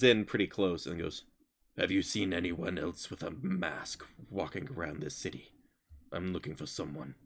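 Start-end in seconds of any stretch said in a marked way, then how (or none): 1.8-7.1 low, quiet menacing figure; hushed, low voice of a searcher; suspicious hulking stranger. Acted. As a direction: lean in close and question softly, pitched down. Voices Bolt confronting a player.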